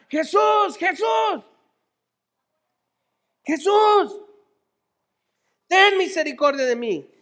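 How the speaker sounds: high, long-held cries; desperate shout. Acted. Blind man begging Jesús for mercy, desperate shout. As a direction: call out pleading into the crowd. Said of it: "¿Cómo grita un ciego desesperado".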